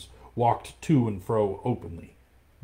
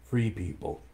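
put-on softer, tired old leader; weary; 3 dB quieter than usual. Old Akela addresses the pack, weary and soft. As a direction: sigh it out, worn down. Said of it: "raised his old head wearily".

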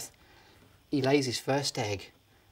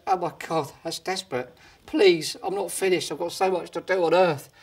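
pleading; 3 semitones higher, 3 dB louder than usual. Acted. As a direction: plead to be let back. Dave pleading to return.

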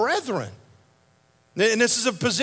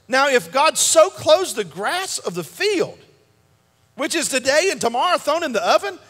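preaching scripture; 4 semitones higher than usual. Acted. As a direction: build the Bible reading into a sermon climax. Reads scripture with rising force.

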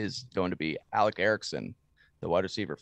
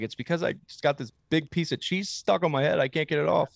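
crying fan voice; 7 semitones higher, 4 dB louder than usual. Retold or imitated. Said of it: "What if she was like".

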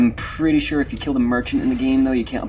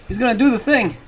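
higher, excited call of anticipation; pitched up, eager exclamation; 9 semitones higher, 4 dB louder than usual. Real, not acted. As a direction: say it with a jolt of excitement. Repeats it in excitement.

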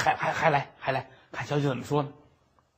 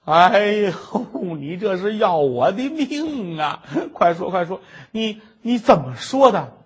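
louder, slow insistent begging; pleading impatience; 3 semitones higher, 4 dB louder, slower than usual. Acted. Scholar urges the maid to tell him, impatient pleading. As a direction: press her desperately to speak.

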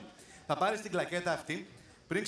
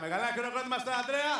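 raised, rousing emcee call; applause call; 6 semitones higher, 3 dB louder than usual. Real, not acted. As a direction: introduce the volunteer to big applause. MC calls for applause.

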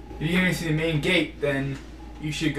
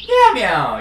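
playful meow; 5 semitones higher, 9 dB louder than usual. Pretend. Playful meowing at cat.

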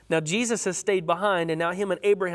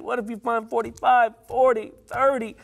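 higher, louder pleading with God; Abraham pleading, pitch up and pressing; pouring out his heart; 6 semitones higher, 4 dB louder than usual. Acted. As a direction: beg the Lord, pouring your heart out. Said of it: "Notice Abraham's pleading. He's pouring out his heart".

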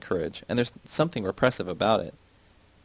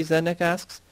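ordinary delivery, nothing marked

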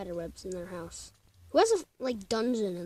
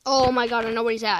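excited; 9 dB louder, faster than usual. Real, not acted. Sudden realisation in horror game.

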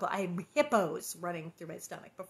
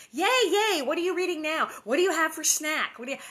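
excited husband; 7 semitones higher, 7 dB louder than usual. Retold or imitated. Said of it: "He's like".

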